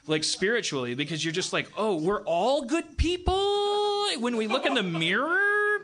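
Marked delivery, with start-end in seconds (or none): 1.8-5.8 high, drawn-out mocking singsong; mock self-assurance. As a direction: stretch it out high, parodying smug reassurance. Mocking everyone's comforting self-image.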